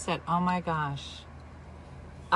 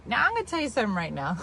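normal talking voice

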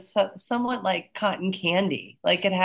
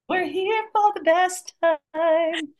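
high, slow sing-song catchphrase; playful sing-song; 11 semitones higher, slower than usual. Pretend. Co-host riffing on a party motto, slow and sing-song. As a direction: stretch it out like a cheerful jingle.

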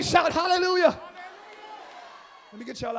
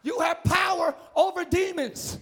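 ordinary talk, nothing marked

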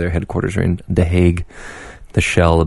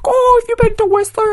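very high gushing mock voice; woman quoted. Seatmate gushing about Whistler, high mock voice. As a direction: gush it like an eager stranger. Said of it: "She's like".

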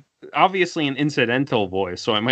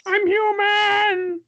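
anime scream; slower than usual. Retold or imitated.

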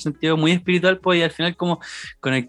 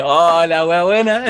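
louder, drawn-out mock amazement; retold delight; 3 semitones higher, 6 dB louder, slower than usual. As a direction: stretch it out like a delighted stoner. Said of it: "yo dije".

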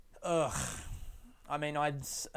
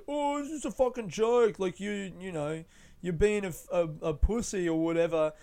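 higher, mock-flippant brush-off; dismissive mocking; 4 semitones higher than usual. Young comic brushing off a colleague, mock-flippant and higher. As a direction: wave it off like it's nothing, pitched up. Retelling his dismissive reply.

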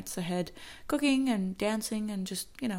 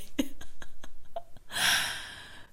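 breathy trailing laugh. Real, not acted. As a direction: laugh it out before resuming. Laughs at own accent.

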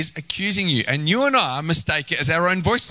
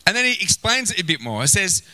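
normal talking voice

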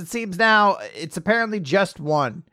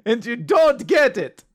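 mock wounded; 6 semitones higher than usual.

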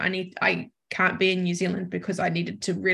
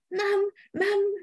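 cat pleading; 10 semitones higher than usual. Retold or imitated. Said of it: "Maisie just sat still and was like".

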